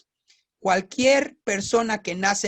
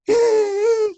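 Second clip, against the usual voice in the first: mock baby wail; 13 semitones higher, 7 dB louder than usual. Baby wails to get attention.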